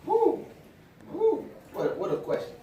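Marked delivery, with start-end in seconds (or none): 0.0-1.4 high excited woo; excited whoop. Real, not acted. Preacher reacts to question.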